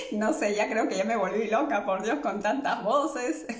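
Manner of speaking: talking through giggles; laughing. Real, not acted. Joking to viewers she's gone crazy, laughing. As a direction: say it half laughing at yourself. Laughs reading own joke.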